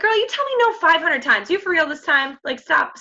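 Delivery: mock exasperation at a friend who keeps saying no; sassy girlfriend talk. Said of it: "I, like, talk like that".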